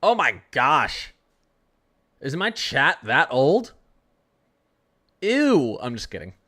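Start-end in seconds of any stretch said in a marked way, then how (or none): 0.0-3.7 slow, louder, drawn-out gasp of disbelief; mock shock. Real, not acted. Mock shock at old viewers. 5.2-5.8 high, loud playful ew; teasing ew; mock disgust.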